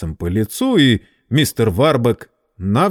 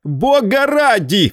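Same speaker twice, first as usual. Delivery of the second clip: high, slow, loud outcry; a high startled yell; startled cry; 5 dB louder, slower than usual. Acted. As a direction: cry out in shock, high and drawn out. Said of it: "вскрикнул".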